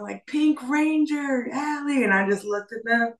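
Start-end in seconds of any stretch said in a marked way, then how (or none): 0.3-2.0 drawn-out, high-pitched cheer; stretched retold yell, pitched way up; mock corner yell. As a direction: imitate a corner calling out slowly to their fighter. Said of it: "Her corner was yelling".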